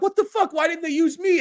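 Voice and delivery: real shock, voice jumps up; high astonished outburst; stunned surprise. Retold or imitated. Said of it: "I was like".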